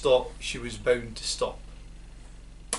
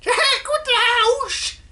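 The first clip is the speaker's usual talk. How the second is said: screeching hag, very high and loud; old hag screeching from above; old screechy voice; 7 dB louder than usual. A narrator's reading. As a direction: shriek it like a gleeful witch. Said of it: "she shouted".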